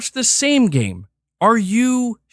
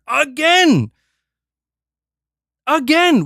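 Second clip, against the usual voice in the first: louder, higher burst of frustration; exasperated shout; 6 semitones higher, 4 dB louder, slower than usual. Real, not acted. Streamer ranting at viewers, shouting in exasperation. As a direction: throw the word out in disbelief. Ranting about Microsoft's release drought.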